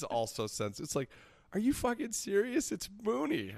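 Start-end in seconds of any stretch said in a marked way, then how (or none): 1.5-2.7 high, incredulous mock outrage; exasperated mock disbelief. Mock exasperated fan quote. 2.7-3.5 high, incredulous scoff; exasperated disbelief. Retold or imitated. Exasperated quote, second take.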